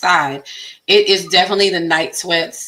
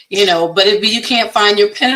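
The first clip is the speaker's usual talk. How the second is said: usual speaking voice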